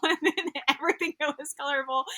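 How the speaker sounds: amused, giggly escalation; laughing. Real, not acted. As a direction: break into a laugh while talking. Laughing at own growing wardrobe.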